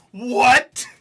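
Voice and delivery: high, loud shouts of disbelief; real hype yelling, laughing at the end; shocked excitement. Real, not acted. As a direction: yell it at the screen, blown away. Shocked reaction to the verse.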